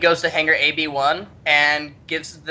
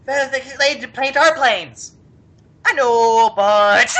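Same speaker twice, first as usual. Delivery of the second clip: loud, high, badly faked Canadian accent; hammed-up mock Canadian, close to laughing; 8 semitones higher, 5 dB louder, slower than usual. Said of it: "I can't do a Canadian accent".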